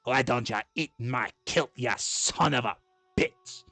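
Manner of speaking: indignant Scottish dwarf; Scottish accent. Acted. Scot objecting to being called a dwarf, thick Scottish accent. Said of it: "I can do Scottish".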